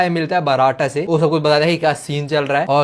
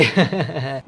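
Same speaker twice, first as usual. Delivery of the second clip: low, soft chuckle; suggestive chuckle; 5 semitones lower, 4 dB quieter than usual. Real, not acted. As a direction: chuckle instead of finishing the sentence. Suggestive joke left unfinished. (दबी, शरारती हँसी)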